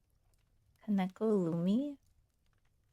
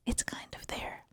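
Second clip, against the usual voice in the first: whisper; 5 dB quieter than usual. Shown as a demonstration. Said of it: "head voice whispering".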